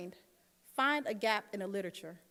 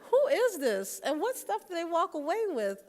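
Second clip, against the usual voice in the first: startled; 8 semitones higher, 5 dB louder than usual. Retold or imitated. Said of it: "And I'm like".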